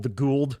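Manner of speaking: Goa'uld said as "gold", one flat syllable; Goa'uld as Hammond says it, like "gold"; Texan Goa'uld. Shown as a demonstration. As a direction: say Goa'uld like "kuld" in a Texan drawl. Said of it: "He calls it the Goa'uld".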